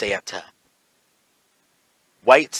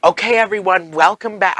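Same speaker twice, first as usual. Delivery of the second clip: big high-pitched, loud YouTuber greeting; hammed-up announcer hello; overly peppy host welcome; 7 semitones higher, 7 dB louder than usual. Shown as a demonstration. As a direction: welcome viewers back with showy cheer. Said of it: "That overly, like, "Welcome to the channel".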